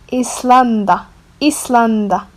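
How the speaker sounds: Islanda with short i and s, open a in lan; Moroccan pronunciation. Shown as a demonstration. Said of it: "we just changed the pronunciation".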